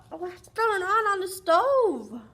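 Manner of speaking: ordinary delivery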